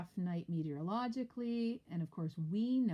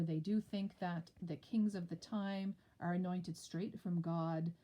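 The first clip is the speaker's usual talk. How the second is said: usual speaking voice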